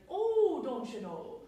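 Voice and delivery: put-on playful Minnesota accent with a long round O. Teacher joking in a Minnesota accent to show the O sound. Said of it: "like Minnesota".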